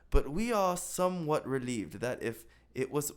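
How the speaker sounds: normal talking voice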